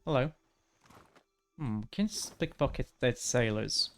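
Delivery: ordinary talk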